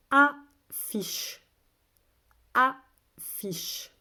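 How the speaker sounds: slow, loud, separated syllables. Shown as a demonstration. Teacher has children listen for A, syllable by syllable. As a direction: sound the word out in two beats. Teacher sounding out word for pupils.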